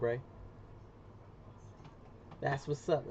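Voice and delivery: ordinary talk, nothing marked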